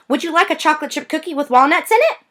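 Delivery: high, fast, bright sales pitch; eager offer. Acted. Girl offering the clerk a cookie, high and quick. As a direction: rush the offer out sweetly.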